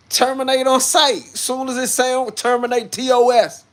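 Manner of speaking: high, forceful proclamation; shouted slogan. Real, not acted. Streamer explaining what T O S means, shouted slogan. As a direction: holler it out like a battle cry.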